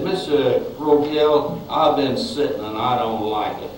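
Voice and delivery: lightly voiced Louisiana Southerner, complaining; a hint of a Southern accent, half-hearted. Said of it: "he had this very southern accent".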